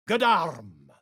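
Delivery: ordinary talk, nothing marked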